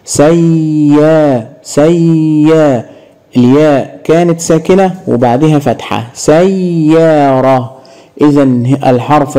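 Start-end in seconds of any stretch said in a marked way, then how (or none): 0.1-2.9 سيا stretched very slowly, the doubled ya held; syllable drill. Shown as a demonstration. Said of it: "انطق، وعايزين نعرف مكان الشدة". 6.1-7.7 سيارة slowly with doubled ya; slow model word. Shown as a demonstration. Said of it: "انطق".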